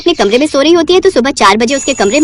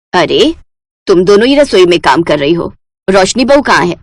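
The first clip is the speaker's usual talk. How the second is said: put-on low, stern elderly voice; a matriarch checking up on her bahus; stern mother-in-law; 6 semitones lower than usual. Mother-in-law questioning the two elder bahus, low and stern.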